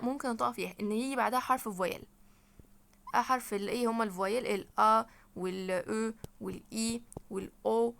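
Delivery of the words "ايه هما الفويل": usual speaking voice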